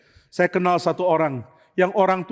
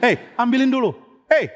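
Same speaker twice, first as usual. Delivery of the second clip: short, raised "hey" to a spouse; curt call; 8 semitones higher, 4 dB louder than usual. Retold or imitated. Parent summoning a spouse with one word, curt call. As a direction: call out brusquely, higher pitched. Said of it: "pakai kata singkat".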